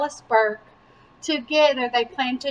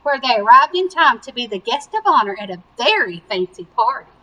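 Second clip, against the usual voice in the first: ordinary delivery, nothing marked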